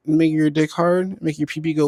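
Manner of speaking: usual speaking voice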